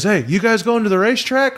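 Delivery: high-pitched, chirpy mock driver; driver calling out. Limo driver to waiting racers, calling out high. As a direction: call it out from a car window.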